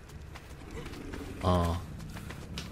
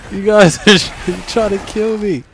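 laughing yell; 7 semitones higher, 7 dB louder than usual. Real, not acted. Laughing at players attacking him.

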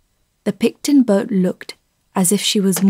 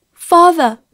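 higher, louder cry after him; 8 semitones higher, 5 dB louder than usual. A narrator's reading. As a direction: call out to father as he leaves. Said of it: "cried Fenella".

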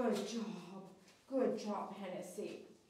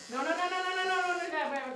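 high, louder string of quick no's; alarmed rapid no's; 8 semitones higher, 5 dB louder than usual. Real, not acted. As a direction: stop the dog in a rush, high and urgent. Dog lunges, owner alarmed.

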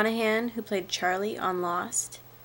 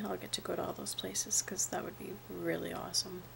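soft breathy whisper; 12 dB quieter than usual. Real, not acted. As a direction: whisper it to yourself. Trailing off into a whisper.

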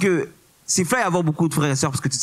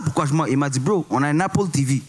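normal talking voice

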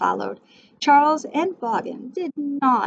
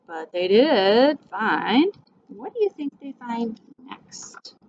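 suspense whisper. Real, not acted. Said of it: "teacher builds suspense".

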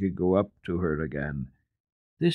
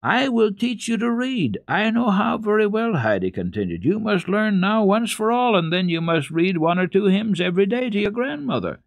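put-on much higher, confident little girl voice; eager girl; 12 semitones higher, 4 dB louder than usual. A narrator's reading. Girl promising to teach the boy, high and eager. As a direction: take charge like a little teacher.

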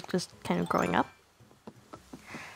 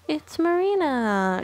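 higher, drawn-out exclamation; excited reveal; 6 semitones higher, slower than usual. Real, not acted. Vlogger revealing who came after Judy, excited exclamation. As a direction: announce the arrival with a slow, raised flourish. Reveals the next villager found.

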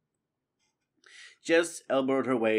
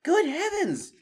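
much higher, louder squeak; Matthias squeaking; 6 dB louder than usual. Acted. Matthias startled by Constance's discovery, high squeak. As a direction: squeak out in surprise. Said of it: "Matthias squeaked".

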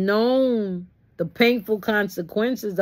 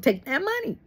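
normal talking voice

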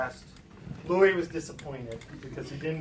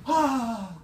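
higher French-Canadian "bonjour, Harriet"; French-Canadian greeting; 5 semitones higher, 4 dB louder, slower than usual. Retold or imitated. Louis greets the storyteller's mother, hearty French-Canadian accent. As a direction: imitate a French-Canadian visitor's hearty hello. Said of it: "That's why he would say my mother's name".

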